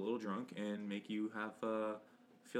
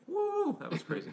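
high, loud stunned whoa; amazed exclamation; 6 dB louder than usual. Retold or imitated. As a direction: blown away, half laughing. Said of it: "A Clockwork Yellow was like".

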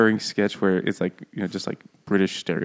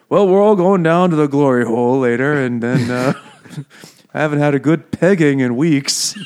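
put-on gruff old villagers; 5 semitones higher, 6 dB louder than usual. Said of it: "all the old gruff men just start saying".

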